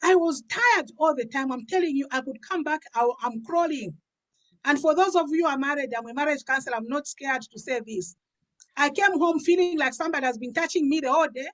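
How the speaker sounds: usual speaking voice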